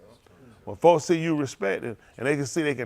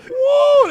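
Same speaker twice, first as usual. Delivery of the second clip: very high whooping cry; high excited whoop; 7 dB louder than usual. Real, not acted. Hyping up the co-host's joke, whooping high. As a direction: let out a shrill woo.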